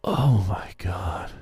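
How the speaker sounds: low, slow groan of despair; exasperated. Real, not acted. Host despairing at co-host's answer, exasperated. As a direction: drag it out low in disbelief.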